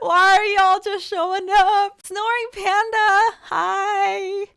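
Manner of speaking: slow, high, loud wail of happy disbelief; excited greeting. Real, not acted. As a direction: call out to arriving viewers, overwhelmed. Greets viewers flooding in.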